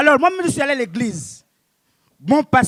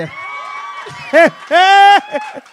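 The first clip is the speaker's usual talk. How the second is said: grand éclat de rire; 8 semitones higher, 7 dB louder than usual. Acted. Rire après sa rime.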